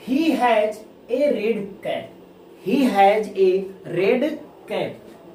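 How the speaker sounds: each word set apart, very slow; slow classroom dictation. Shown as a demonstration. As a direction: read it out word by word for the class to copy. Teacher dictating example sentence.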